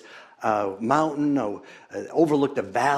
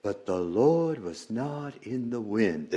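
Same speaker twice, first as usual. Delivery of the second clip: lightly voiced children's chant, softer; children reciting; 5 dB quieter than usual. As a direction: recite it softly like a child in class. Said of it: "and the children would say".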